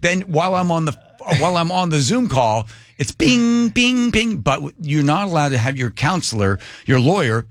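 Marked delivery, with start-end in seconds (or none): 3.0-4.4 high, ringing "bing" like an alert; imitated chime. Borrowed laptop pinging during the Zoom call, imitated chime. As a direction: sing out each ping like a notification.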